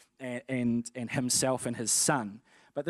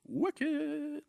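put-on childlike delight; 13 semitones higher than usual. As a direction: stretch it up high in awed glee. Kid's delight at getting stuff.

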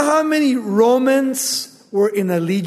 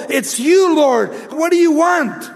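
high, louder cry of tormented dread; fearful demon; 8 semitones higher, 3 dB louder than usual. Acted. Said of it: "tormented and is fearful".

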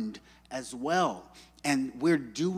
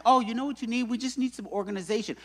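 higher-pitched helpful friend impression; imitating Candace; 7 semitones higher, much faster than usual. Friend advising the pregnant wife, high breezy helpfulness. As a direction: lift the voice, breezily offering a fix.

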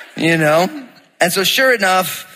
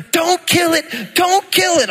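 1 high pleading wife; slower than usual. Retold or imitated.

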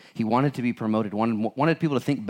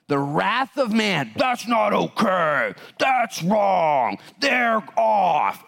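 high, full-force shouting; a preacher roaring against the wrath of man; preaching shout; 8 semitones higher, 4 dB louder, much slower than usual. Real, not acted. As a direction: thunder it out at the crowd.

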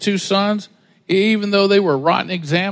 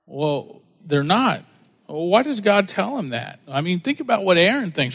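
ordinary talk

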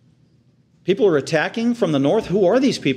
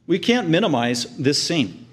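ordinary delivery

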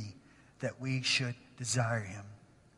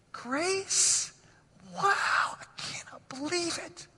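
high, soft, tearful awe; retold crying; 4 semitones higher, 4 dB quieter, slower than usual. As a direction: choke up in wonder at grace. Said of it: "and then I just start crying".

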